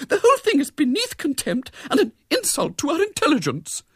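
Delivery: high, loud, relentless tirade; prim, high-pitched outrage; grim indignation. A narrator's reading. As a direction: list each offence with mounting scorn. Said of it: "said Miss Milton grimly".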